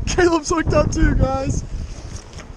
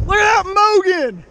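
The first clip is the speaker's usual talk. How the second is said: usual speaking voice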